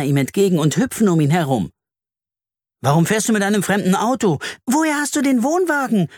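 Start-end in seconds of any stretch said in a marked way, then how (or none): 2.8-6.1 fast, pitched-up questions tumbling out; eager rushed questions. Acted. Kids bombard dad with questions, fast and excited. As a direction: pepper him with questions, much higher. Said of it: "bestürmen sie ihn".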